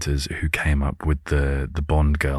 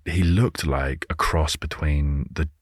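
ordinary talk, nothing marked

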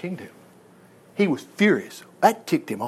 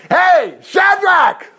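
loud, high, slow call; 12 semitones higher, 6 dB louder, much slower than usual. Acted. King calling Shadrach out of the furnace, loud and high. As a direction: shout it across the flames, drawn out.

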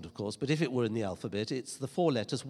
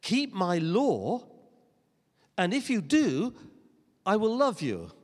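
lightly voiced God, higher and deliberate; God laying down terms; 5 semitones higher, 4 dB louder than usual. Preacher imitates a misunderstood God setting terms, higher and measured. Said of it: "as if God says".

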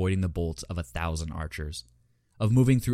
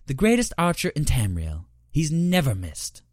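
higher, teasing female daedra; Horavatha voice; 8 semitones higher, 3 dB louder than usual. Acted. Horavatha praises the archer.

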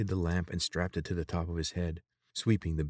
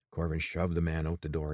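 ordinary delivery, nothing marked

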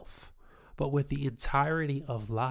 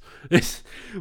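short loud laugh; real laugh; burst of laughter; 5 semitones higher, 8 dB louder than usual. Real, not acted. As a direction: laugh out at the joke. Laughing at loop joke.

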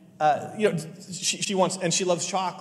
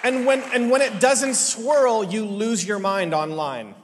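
loud, high preacher's build; full-force preaching; 6 semitones higher, 7 dB louder than usual. Acted. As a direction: drive the point home to the congregation.

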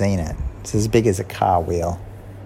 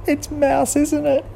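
much higher Kat voice; Kat realising. A narrator's reading. Kat to Girl, much higher and sheepish. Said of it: "And Kat said".

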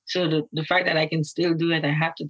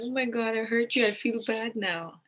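higher, fading soft by the end; a light imitation of remorse, higher then hushed; mock guilty apology; 5 semitones higher, 4 dB quieter than usual. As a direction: imitate a guilty partner, trailing off quietly. Partner apologizing, retold.

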